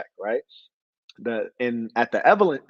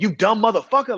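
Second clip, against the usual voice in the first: mock yell; 8 semitones higher, 5 dB louder than usual. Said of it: "he calls him something like".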